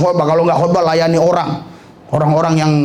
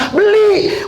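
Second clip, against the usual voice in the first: emphatic high shout; 12 semitones higher than usual. Retold or imitated. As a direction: bark the order to buy. Exclaimed purchase order.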